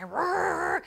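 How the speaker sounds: high-pitched mock growl; imitated grumbling. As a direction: growl back like a grouch. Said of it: "he would be grumbling".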